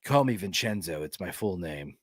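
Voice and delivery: a mild, prim insistence; insisting on full name. Pretend. To friends, pretend self demanding his full name, low and soft. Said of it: "imagine if I insisted".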